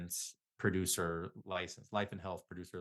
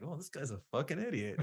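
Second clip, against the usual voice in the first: higher scornful mock voice; mocking coworker; 7 semitones higher than usual. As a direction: sneer like a dismissive coworker. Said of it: "It's kinda like".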